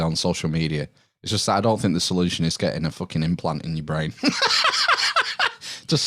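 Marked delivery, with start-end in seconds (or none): 4.2-5.8 a high, real laugh at his own joke; burst of laughter. Real, not acted. Guest laughing at his own implant joke, real laughter.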